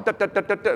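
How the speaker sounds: high staccato "duh duh" rifle shots; playful imitated volley; mock gunfire. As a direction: rattle it off like muskets firing. Soldiers firing in a line.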